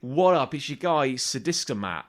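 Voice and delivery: big showy presenter patter, drawing out the key words; hyped video host. Retold or imitated. Comedian mimics a stunt vlogger greeting viewers, loud mock hype.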